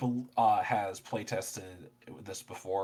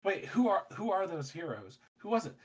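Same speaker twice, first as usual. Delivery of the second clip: lightly voiced, higher curious onlooker; wondering townsperson; 8 semitones higher than usual. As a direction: play a puzzled bystander asking around. Voicing townsfolk asking about the heroes.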